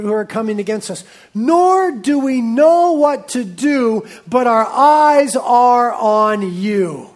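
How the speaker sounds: slow, loud pleading to God; earnest loud prayer to God; fervent prayer. Acted. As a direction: plead it out slowly and loudly. Prayer read out with force.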